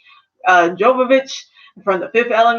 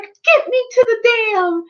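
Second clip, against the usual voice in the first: high, slow mock heroic demand; 11 semitones higher than usual. Anna's determined demand.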